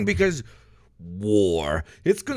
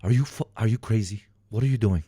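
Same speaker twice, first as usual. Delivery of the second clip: lower, quieter stern officer; mock officer; 7 semitones lower, 3 dB quieter than usual. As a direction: stop him like a shocked commander. Commanding officer voiced.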